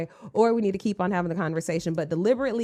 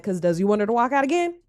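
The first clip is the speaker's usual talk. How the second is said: ordinary talk, nothing marked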